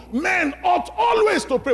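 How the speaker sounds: proclaimed loud and high, punching always; shouted scripture. Acted. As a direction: shout the verse to the back row.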